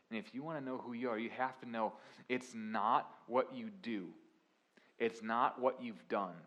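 usual speaking voice

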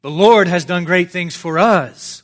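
crowning the refrain with a joyful cry; rising proclamation. Acted. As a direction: lift the repeat to a joyful peak. Psalm repeat, climbing higher.

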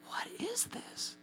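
slow, high stage whisper; bewildered whisper. Retold or imitated. Staring at a woman praying in tongues, bewildered whisper. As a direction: whisper it slowly in disbelief.

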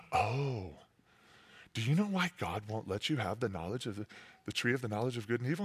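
low, soft, sly hush; crafty snake. Acted. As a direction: draw them in like a scheming serpent. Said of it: "more crafty than all the other animals".

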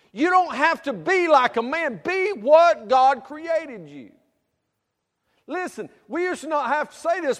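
high, loud full-force sermon; fiery preaching. Acted. Preacher calling out to young women and men, loud and fervent. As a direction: exhort them, every line pushed hard.

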